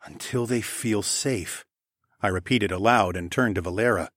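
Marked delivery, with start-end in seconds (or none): 0.0-1.6 slow, quiet dawning realization; stunned realization. A narrator's reading. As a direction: freeze as it dawns. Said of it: "and then froze".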